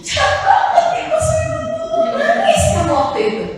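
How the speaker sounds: hammed-up sobbing complaint to God; mock wronged crying. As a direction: imitate someone wailing about being betrayed. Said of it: "nangis ketika merasa terbolimi".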